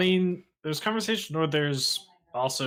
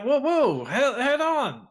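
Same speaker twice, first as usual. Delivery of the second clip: much higher, louder startled whoa; startled protest; 13 semitones higher, 6 dB louder than usual. Acted. Sky protesting as the bouncer grabs his arm. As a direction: yelp it as hands grab you.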